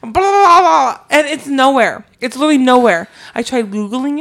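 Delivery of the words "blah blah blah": horse voice. Retold or imitated. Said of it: "the horse is all like".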